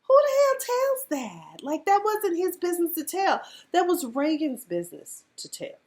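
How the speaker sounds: high indignant quote; mock indignant group. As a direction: scold like the group did. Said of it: "Everybody's like".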